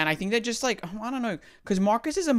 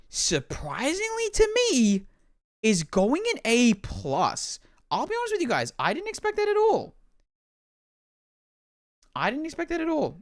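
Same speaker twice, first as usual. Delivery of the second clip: much higher, amazed delivery; surprised; 8 semitones higher than usual. Real, not acted. Streamer revealing unexpected rating, high surprise. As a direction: sound genuinely astonished.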